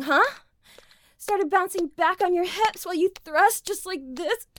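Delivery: ordinary talk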